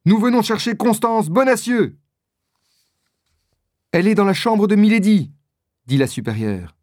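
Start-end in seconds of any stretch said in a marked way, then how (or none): 0.0-2.0 high, urgent calling out; high-pitched, declaiming cry; shouted announcement. A narrator's reading. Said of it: "crie D'Artagnan". 3.9-5.3 high, loud, hurried answer; fast, raised-voice exclamation; urgent reply. A narrator's reading. Mother superior answers the urgent call.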